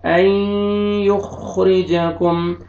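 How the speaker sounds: "युखरिजाकुम" slow, with the nasal ghunna held; measured Quranic chanting; slow recitation. Shown as a demonstration. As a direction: recite it slowly, tajweed style. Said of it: "teacher models recitation".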